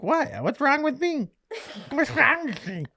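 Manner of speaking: put-on squeaky worried child; high frantic "what's wrong with me"; high panicked kid voice. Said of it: "And I was like".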